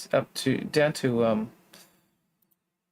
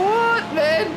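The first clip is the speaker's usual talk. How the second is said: surprised. Real, not acted. Shocked at race result.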